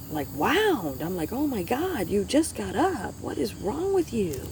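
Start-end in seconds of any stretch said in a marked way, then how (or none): 1.3-4.5 higher, slowed exasperation; exasperated. Retold or imitated. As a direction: drag it out in disbelief. Retelling exasperation at husband.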